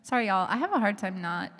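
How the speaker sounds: usual speaking voice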